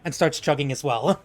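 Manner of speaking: ordinary talk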